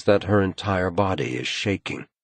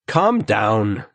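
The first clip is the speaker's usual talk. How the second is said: irritable; 4 semitones higher, 6 dB louder than usual. A narrator's reading. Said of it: "says Erik irritably".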